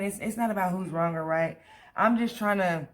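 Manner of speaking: ordinary delivery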